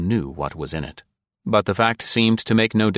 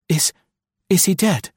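put-on higher, halting young woman, shaken; unsteady frightened question; 12 semitones higher, 4 dB louder than usual. A narrator's reading. Nancy asking Val beside the body, frightened and unsteady. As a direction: stammer it out, scared of the answer. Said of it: "she asked unsteadily".

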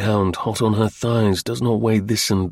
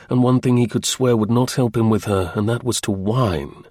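usual speaking voice

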